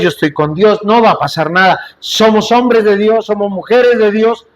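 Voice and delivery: normal talking voice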